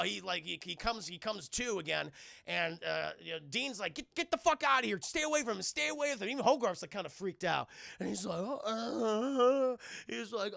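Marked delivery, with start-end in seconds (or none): 3.9-6.3 high, urgent acted yell; angry warning. Man chasing robot away. 8.4-9.8 drawn-out groan in robot voice; dismayed robot. Retold or imitated.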